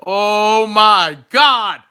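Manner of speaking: drawn-out, slow awestruck "Oh my God"; stunned surprise. Retold or imitated. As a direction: stretch it out slowly in amazement. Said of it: "I was like".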